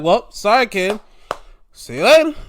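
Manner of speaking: loud, high-pitched brush-off; imitated taunting goodbye; mock farewell. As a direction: wave him off with a gleeful fake apology. Acts dismissing the rejected wrestler.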